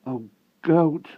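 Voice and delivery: high, distressed plea; broken voice. A narrator's reading. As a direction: call out as if your voice is breaking. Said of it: "said the wart in a broken voice".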